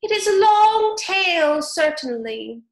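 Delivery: high, loud squeaky mouse voice; a squeaky little mouse lamenting his tale; sighing mouse. A narrator's reading. Mouse sighing to Alice, high squeaky lament.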